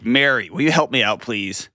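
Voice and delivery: ordinary delivery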